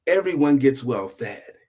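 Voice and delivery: plain reading voice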